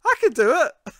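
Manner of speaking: loud, high, over-keen outburst; mock eagerness. Imitating Wesley insisting to the captain, shrilly eager.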